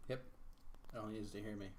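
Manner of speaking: normal talking voice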